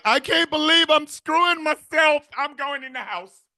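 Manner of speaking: high, put-on voice of a repulsed clone, trailing off. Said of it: "Be like".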